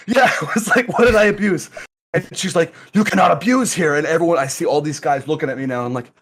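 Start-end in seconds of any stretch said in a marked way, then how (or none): 0.9-1.7 high, loud baffled shout; indignant shout. Retold or imitated. Protesting the accusation, shout heard. 2.9-3.9 a hint of a stern officer, high and loud; stern lady.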